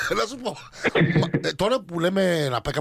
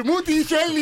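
mock pet name; 8 semitones higher, 4 dB louder, slower than usual. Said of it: "Το λέω και το σχολιάστε".

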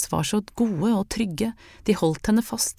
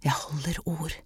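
much softer, hushed promise into her ear; whispered; 9 dB quieter than usual. A narrator's reading. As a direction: breathe it right into her ear. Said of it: "hvisket han inn i øret hennes".

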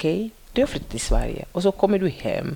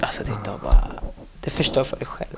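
lower, unvoiced whisper; whispered aside; 9 semitones lower than usual. Real, not acted. Police officer confides her calm advice, whispering. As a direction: whisper it like a secret. Advice not to get angry.

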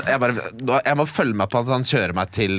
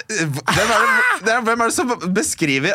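incredulous; 10 semitones higher, slower than usual. Real, not acted. Disbelief at driver's words.

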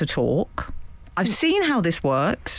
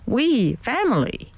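cute dog voice; 5 semitones higher than usual. Retold or imitated. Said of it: "She says incredibly cute things like".

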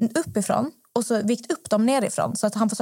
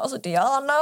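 higher-pitched, mortified plea in retelling; mock embarrassed reproach; 4 semitones higher than usual. Teller to friend who just yelled, retold mortified reproach. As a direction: play at chiding an embarrassing friend.

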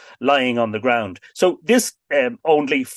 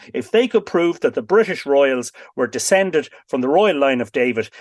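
ordinary talk

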